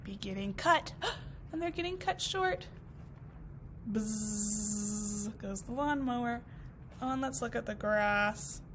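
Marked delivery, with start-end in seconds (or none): 3.9-5.4 slow low buzz; mower buzz. Acted. Lawnmower cutting the grass, slow low drone.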